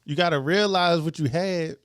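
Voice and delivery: ordinary delivery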